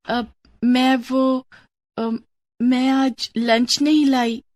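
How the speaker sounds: slow, halting embarrassed reply; hesitant embarrassed girl. Acted. Reena ashamed to show her lunch to friends, slow and halting. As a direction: stall shyly, ashamed.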